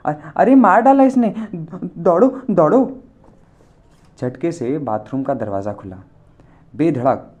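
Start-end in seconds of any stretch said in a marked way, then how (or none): 0.1-3.0 high, loud terrified wail. A narrator's reading. Old gora screams for help, high terrified wail.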